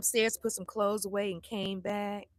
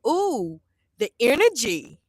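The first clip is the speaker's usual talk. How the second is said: mock son teasing; 8 dB louder, slower than usual. Son mocking mom's mood.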